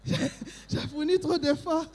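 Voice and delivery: breathless laughing. Real, not acted. Breathless laugh after dancing.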